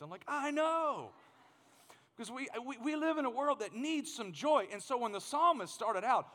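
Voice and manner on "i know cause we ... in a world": delighted agreement. Retold or imitated. Dad to kids who found the film hilarious, delighted. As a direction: sing it out high, long and thrilled.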